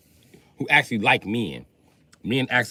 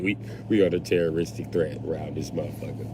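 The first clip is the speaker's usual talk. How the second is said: normal talking voice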